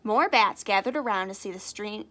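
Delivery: ordinary delivery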